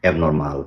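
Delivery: অ্যাবনরমাল ending in a flat open "mal"; wrong mal ending. Shown as a demonstration. Teacher lists common mistakes, অ্যাবনরমাল with "mal". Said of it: "মালের একটা গন্ধ পেয়েছি".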